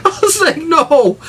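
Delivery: squeaky high laughing no; real laughing disbelief. Real, not acted. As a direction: gasp it out mid-laugh.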